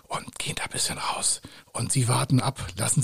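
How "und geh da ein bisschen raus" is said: soft whisper; whisper, stepping away from the mic. Real, not acted. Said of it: "ich bin jetzt mal ganz leise".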